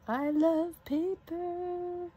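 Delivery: high, slow, drawn-out gush over paper; dreamy high gushing; gushing excitement. Real, not acted. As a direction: swoon over the paper like it's a treasure. Gushing over craft supplies.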